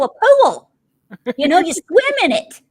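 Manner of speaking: loud, high Southern insistence; exasperated Southern accent. Retold or imitated. Southerner explaining pool to a puzzled northerner, exasperated Southern accent. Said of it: "I add a syllable to everything".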